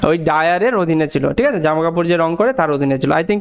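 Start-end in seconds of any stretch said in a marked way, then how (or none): none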